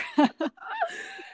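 high bright burst of laughter; laugh, touched and happy; delighted laugh. Real, not acted. Delighted by the introduction.

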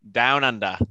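jokey Australian accent attempt. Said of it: "the accent, uh, should probably stay".

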